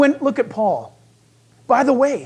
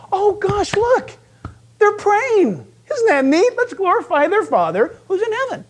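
high-pitched, wide-eyed fake admiration; mock gushing awe; 10 semitones higher than usual. Preacher mimics the world admiring praying believers, mock gushing. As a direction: gush as if hugely impressed, hamming it up.

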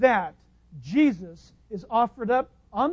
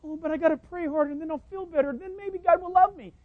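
mock worried believer; 6 semitones higher, faster than usual. Mocking an anxious believer.

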